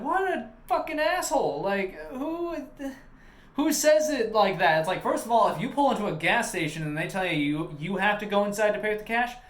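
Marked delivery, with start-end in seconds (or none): 0.0-4.8 much higher, exasperated disbelief; fed-up clerk venting, pitch jumping up; indignant outburst. Retold or imitated. Clerk retelling his outrage at a customer who refused to pay inside, high indignant voice.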